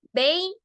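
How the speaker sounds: bay with a long A, said like "ey"; A larga. Shown as a demonstration. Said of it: "Se pronuncia A". (bay con la A larga, como "ei")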